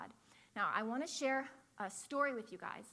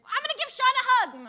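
high, loud determined cry; eager shout; 16 dB louder than usual. Retold or imitated. The student calling to the dad while chasing Sean. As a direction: shout it mid-chase, thrilled.

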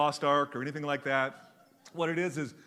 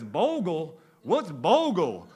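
country guy; 2 semitones higher, 6 dB louder, slower than usual. Retold or imitated. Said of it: "He says".

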